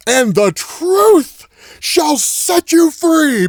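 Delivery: high, loud and very slow, drawn out with gravity; dramatic Batman line. Retold or imitated. Retelling Batman's amazing line to viewers, slow, high and loud. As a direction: deliver it like the hero's big reveal. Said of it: "Batman gives us this amazing line".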